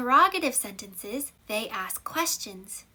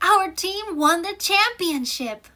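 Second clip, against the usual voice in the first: high, loud victory cry; triumphant cheer; 7 semitones higher, 6 dB louder than usual. Shown as a demonstration. Teacher demonstrating an exclamation to the class, cheering. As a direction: cheer it like your team just won. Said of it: "Exclamation mark".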